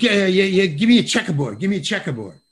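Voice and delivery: retold hurried request, starting high and loud, then easing; urgent demand. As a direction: pester the prop man in a mock rush. Actor hurrying prop man before shoot.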